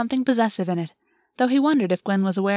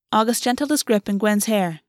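ordinary delivery, nothing marked

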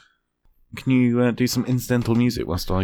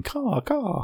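high squeaky animal call; 12 semitones higher, 4 dB quieter than usual. Retold or imitated. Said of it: "an animal saying".

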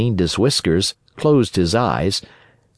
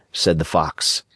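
usual speaking voice

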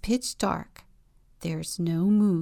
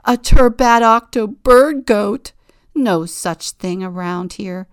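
very loud, big trumpeting voice; booming creature voice; 4 semitones higher, 12 dB louder than usual. Acted. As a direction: bellow it out.

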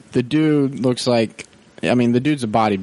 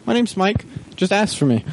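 high, overly nice clerk voice; sweet helpful clerk; 8 semitones higher than usual. Retold or imitated. Clerk cheerfully sending the customer to Mike, high sweet voice. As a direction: play innocent and helpful. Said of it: "I was like".

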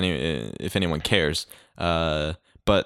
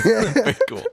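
laughing high; 13 semitones higher than usual. Real, not acted. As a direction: giggly squeak.

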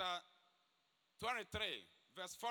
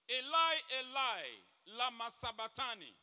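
Eli, Eli cried out much louder; loud cry; 2 semitones higher, 7 dB louder than usual. A narrator's reading. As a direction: cry it out in anguish. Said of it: "Jesus cried out with a loud voice".

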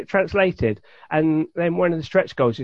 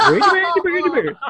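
mock Swedish gibberish; 12 semitones higher, 5 dB louder, faster than usual. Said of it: "everyone knows Swedish sounds like".

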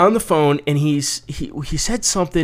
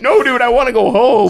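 loud, high-pitched mock plea; mock pleading protest; 9 semitones higher, 5 dB louder than usual. Inmate answering the sergeant, loud high mock plea. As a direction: raise pitch and volume in joking protest.

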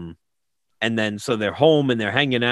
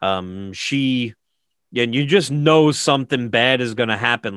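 usual speaking voice

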